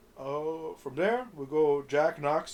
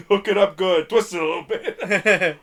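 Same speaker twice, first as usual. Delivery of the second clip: high loud drawled quote; 4 semitones higher, 9 dB louder than usual. Retold or imitated. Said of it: "quoted movie line".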